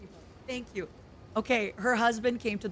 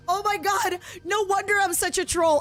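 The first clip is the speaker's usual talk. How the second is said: excited rant; 7 semitones higher, 3 dB louder than usual. Real, not acted. Laughing rant about mother.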